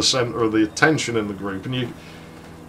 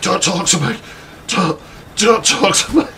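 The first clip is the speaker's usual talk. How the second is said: higher, louder shouted retort; angry outburst; 8 semitones higher, 4 dB louder than usual. Retold or imitated. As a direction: snap back hard, don't let them near. Alice snaps at Lauren.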